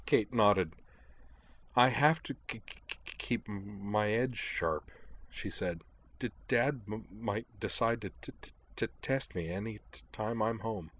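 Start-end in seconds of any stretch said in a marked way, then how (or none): 1.8-4.9 soft, halting stammer on first sounds; a gentle nervous stammer; stammering girl. Acted. Kate's stammering dialogue. 6.2-10.9 soft, halting stammer on first sounds; a gentle nervous stammer; stammering girl. Acted. Kate's stammering dialogue.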